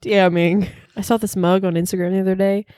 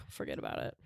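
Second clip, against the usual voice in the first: whispered sigh; 21 dB quieter, faster than usual. Real, not acted. Sighed aside.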